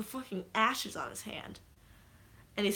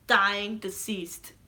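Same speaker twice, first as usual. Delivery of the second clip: ordinary talk, nothing marked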